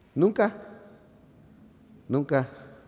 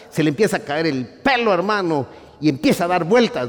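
rising preaching; 5 semitones higher, 5 dB louder than usual. Acted. Preacher to congregation, rising fervent preaching. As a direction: preach it louder and higher, building. Preacher builds up ardilla image.

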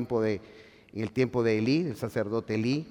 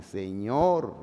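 ordinary talk, nothing marked

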